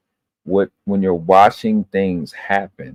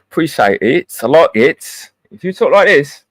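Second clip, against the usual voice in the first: high, lively mock British accent; put-on British accent; 7 semitones higher, 6 dB louder than usual. Streamer showing off a switched-up accent to chat, playful British accent. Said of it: "requests for me to talk like this".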